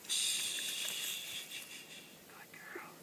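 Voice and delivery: soft whispered hush and praise. Real, not acted. Trainer whispering to calm the dog. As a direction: whisper to settle her.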